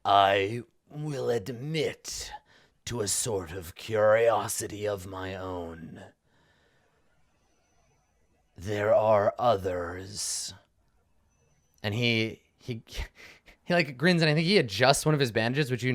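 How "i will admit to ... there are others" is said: slow, drawn-out villain voice; imperious villain. Acted. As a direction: savour each phrase with long pauses. Game master voices the NPC.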